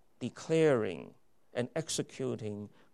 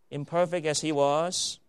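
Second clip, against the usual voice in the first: loud, high, rising preacher's cry; fiery pulpit crescendo; preaching at full force; 5 semitones higher, 10 dB louder than usual. Acted. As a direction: build it up with fervour. Preacher builds to a climax.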